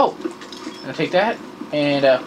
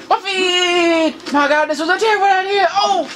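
higher pitched panic while falling; panicked yelp; 10 semitones higher, slower than usual. Real, not acted. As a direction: cry out in mock terror mid-jump. Jumping from a scary height.

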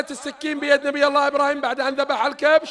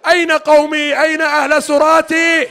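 fervent mourning sermon; 3 semitones higher, 5 dB louder than usual. Real, not acted. Preacher recounting Karbala to mourners, loud. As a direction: hammer the grief home.

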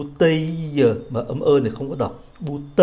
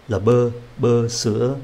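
normal talking voice

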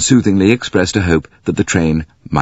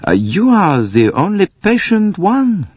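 Poirot voice; 13 semitones higher, slower than usual. Acted. Poirot voice, slowed.

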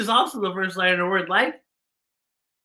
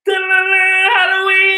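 spooky drawn out; 11 semitones higher, 6 dB louder, much slower than usual. Acted.

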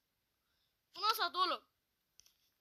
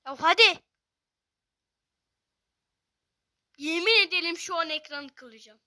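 much louder, furious complaint at the phone; angry rant; 16 dB louder than usual. Real, not acted. Gamer fed up with his lagging phone, loud angry rant. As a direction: rant like you're about to smash the screen.

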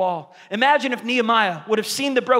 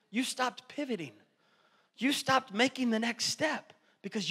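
gentle fatherly; 3 semitones higher, 7 dB quieter than usual. Retold or imitated.

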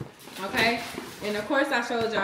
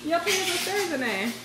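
scolding mama; 8 semitones higher than usual. Real, not acted.

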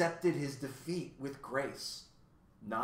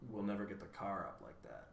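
unhappy; 3 semitones lower, 9 dB quieter than usual. A narrator's reading. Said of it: "said Milo unhappily".

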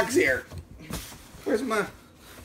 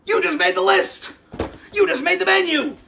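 mock threat; 9 semitones higher, 4 dB louder than usual. Joking threat to Bobby.

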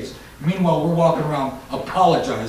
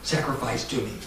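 much softer demonic whisper; Satan's whisper; 5 semitones lower, 13 dB quieter than usual. Retold or imitated. Satan tempting possessed kids, hushed sinister whisper. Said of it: "Satan possesses these kids and says".

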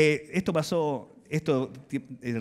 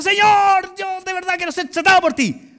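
high, loud pleading; fervent prayer; 12 semitones higher, 7 dB louder than usual. Retold or imitated. Believers loudly offering themselves to God, high and fervent. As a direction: cry out your eager vow. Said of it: "Y estamos diciendo".

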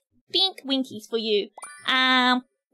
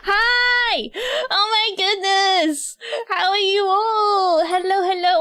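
high-pitched, gushing welcome; excited greeting; 6 semitones higher, 3 dB louder, slower than usual. Real, not acted. Streamer surprised by so many viewers, excited greeting. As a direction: greet the crowd with overflowing excitement. Greeting a big crowd at debut.

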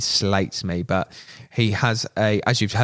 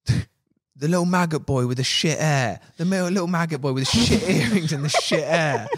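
mocking insult; 6 semitones higher than usual. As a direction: sneer it out like a petty grown man. Mocking an angry customer's insult.

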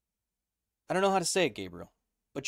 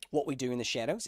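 normal talking voice

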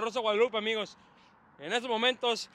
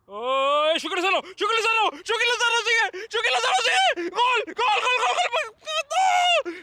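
building to a high screaming peak; frenzied shouting; 11 semitones higher, 6 dB louder, slower than usual. Real, not acted. Fan urging Chucky Lozano on toward goal, frenzied shouting. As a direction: shout faster and higher as the goal nears.

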